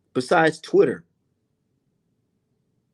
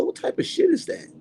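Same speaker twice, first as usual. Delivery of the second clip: high mocking squeak. Repeats the question mockingly.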